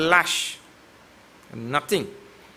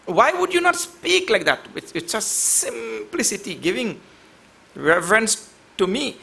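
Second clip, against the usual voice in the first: raised rhetorical question, easing down; sage addressing Ram; 6 semitones higher than usual. Shown as a demonstration. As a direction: ask it high and emphatic, then settle. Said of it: "understand in that kind of tone".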